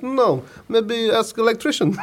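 slow, high mock Polish accent. Carpenter disowning found toy figures, slow Polish accent. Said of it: "this is actually another Polish gentleman".

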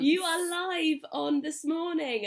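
high, loud, slow breakfast-TV host voice; hammed-up presenter announcing live; mock TV presenter.

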